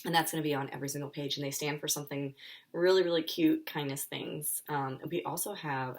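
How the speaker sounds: ordinary delivery, nothing marked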